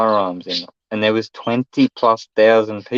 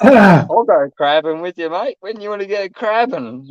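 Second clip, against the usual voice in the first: eager agreement; 9 semitones higher than usual. Retold or imitated. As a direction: ham up your keenness to go. Eagerly agreeing to hunt attacker.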